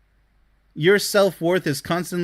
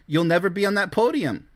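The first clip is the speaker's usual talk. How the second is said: normal talking voice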